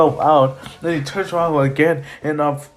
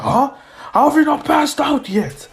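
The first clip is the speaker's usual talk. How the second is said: exasperated villain; 11 semitones higher than usual. Acted. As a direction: play an exasperated villain, pitched way up. Villain taunts struggling victim.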